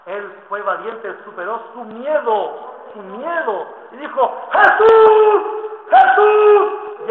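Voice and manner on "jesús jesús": very high, very loud cry of the name; desperate shout. Acted. Blind man calling to Jesus over the crowd, desperate shout. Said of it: "él más gritaba".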